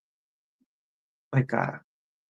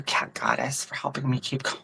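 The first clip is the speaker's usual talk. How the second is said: normal talking voice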